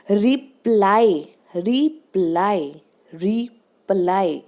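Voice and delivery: slow reply, re-PLY, weight on ply; second-syllable stress. Shown as a demonstration. Teacher showing stress on प, slow re-PLY for reply. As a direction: push from the प in reply. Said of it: "प पे stress आ रहा है".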